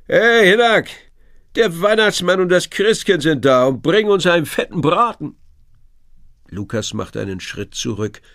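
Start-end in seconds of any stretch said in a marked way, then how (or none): 0.1-5.4 loud, high teasing shout into the cabin; a cheeky fisherman hollering a joke to his mate; joking fisherman calling. A narrator's reading. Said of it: "ruft in die Kajüte".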